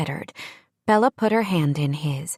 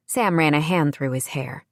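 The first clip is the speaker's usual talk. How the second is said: usual speaking voice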